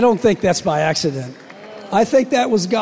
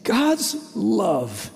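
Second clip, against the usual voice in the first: moved, voice softening and slowing; real emotion breaking through; getting emotional; 2 semitones higher, 3 dB quieter, slower than usual. Real, not acted. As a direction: let the feeling catch in the throat. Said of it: "I'm getting emotional".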